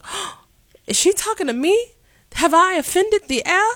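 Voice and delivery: high, hammed-up posh British accent; mock-offended British lady, way up high; British twang. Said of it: "if I spoke in a British twang".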